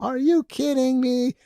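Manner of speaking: high, loud and drawn out; floored by the daughter's suggestion; slow, stunned exclamation; amazed disbelief. Retold or imitated. Reacting to daughter's idea.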